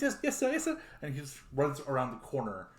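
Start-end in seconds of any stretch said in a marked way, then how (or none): 0.0-0.8 high, loud, hurried yes-sir; startled young servant voice, pitched way up; eager small boy. Acted. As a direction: play a little boy snapping to attention, high-pitched. Small boy jolts awake, answers.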